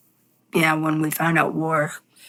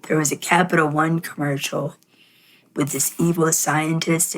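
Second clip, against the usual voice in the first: ordinary talk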